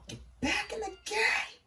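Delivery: high, loud frantic yell; shouting. Real, not acted. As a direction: yell it, desperate to respawn. Urging himself back into play.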